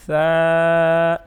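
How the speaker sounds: long, loud soft ثا, held on one note. Shown as a demonstration. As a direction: draw out ثا with a soft consonant. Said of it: "نرم حرف ہے". (نرم اور لمبا ثا)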